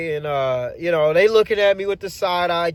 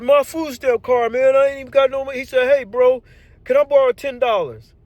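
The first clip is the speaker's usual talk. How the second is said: higher, louder pleading buddy; lightly voiced begging coworker; coworker asking money; 6 semitones higher, 3 dB louder, faster than usual. Coworker asking the driver for ten dollars, higher voice.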